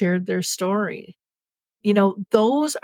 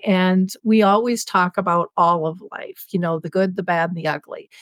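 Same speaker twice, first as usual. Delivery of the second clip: ordinary talk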